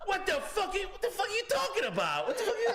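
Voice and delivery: very high, sputtering mock indignation; outraged mobster. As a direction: imitate a flustered tough guy, high-pitched.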